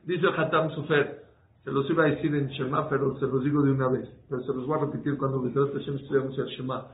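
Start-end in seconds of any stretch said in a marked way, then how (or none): none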